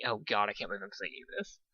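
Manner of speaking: muttered under the breath; quiet aside. Real, not acted. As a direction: drop out and grumble softly. Narrator breaks character.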